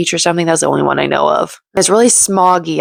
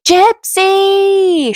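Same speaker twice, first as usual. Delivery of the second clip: calling out a name; 3 dB louder than usual. Retold or imitated. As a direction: call her name from a distance. Fans calling her name.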